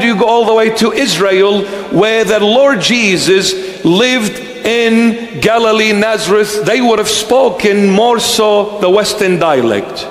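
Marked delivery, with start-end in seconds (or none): none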